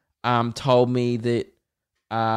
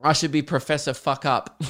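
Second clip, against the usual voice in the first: usual speaking voice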